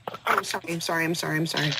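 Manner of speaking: fading apologies. Acted. Apologizing, voice shrinking.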